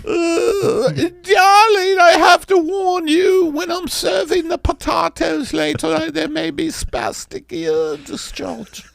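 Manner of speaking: put-on very high, loud falsetto English accent, slowing at the end; English mother. Mother warning darling son over the potatoes, high mock-English accent. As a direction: declaim it as a hammed-up British matron. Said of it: "talking in an English accent".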